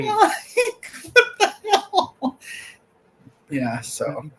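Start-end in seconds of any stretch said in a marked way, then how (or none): 0.0-2.4 very high, squeaky laughing words; laughing disbelief. Real, not acted. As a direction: laugh helplessly through the words. Laughing over the retold encounter.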